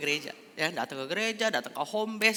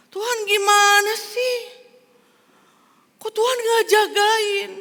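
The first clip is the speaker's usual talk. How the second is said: John angry again; 10 dB louder than usual. Acted. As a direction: plead and accuse God at once. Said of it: "John marah lagi".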